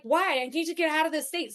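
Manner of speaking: mock panic to the daughter; frantic. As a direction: plead your escape, fast. Own panicked reply retold.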